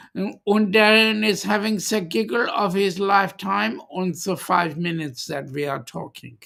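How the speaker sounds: put-on German accent. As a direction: slip into a mock German accent. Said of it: "put on any other accents".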